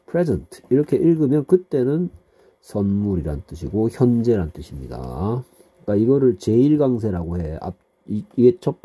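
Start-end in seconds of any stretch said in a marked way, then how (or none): none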